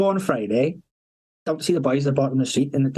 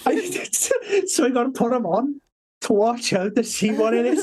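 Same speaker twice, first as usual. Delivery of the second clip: higher pitched, laughing as he quotes; can barely get the words out for laughing; real giggling while retelling; laughing through words; 9 semitones higher, slower than usual. Real, not acted. Laughing while quoting the porn story.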